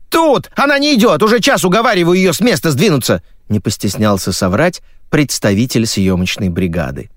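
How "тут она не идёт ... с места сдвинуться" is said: high, agitated call; exasperated shouting back. A narrator's reading. Crew member complains about the stubborn guest, exasperated shout. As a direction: yell back, then rush the complaint.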